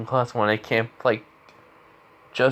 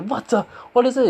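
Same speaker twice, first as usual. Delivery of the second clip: mock-alarmed question; startled; 12 semitones higher than usual. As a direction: blurt it out pitched way up. Acted startled reaction to thrown balls.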